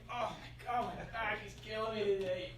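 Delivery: pained mumble. Retold or imitated. Husband complaining of back pain near recovering wife, soft moan. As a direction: moan it quietly to yourself.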